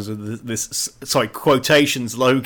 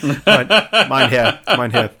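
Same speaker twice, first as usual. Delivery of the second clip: short mock German address; mock German accent. Host correcting his joke, mock German accent. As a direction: play at a German accent between laughs. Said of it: "I stuck a German accent".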